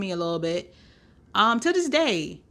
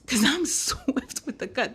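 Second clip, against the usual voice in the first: laughing whisper; 4 semitones higher than usual. Real, not acted. Bragging about cutting people off.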